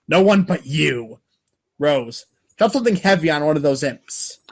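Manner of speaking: ordinary talk, nothing marked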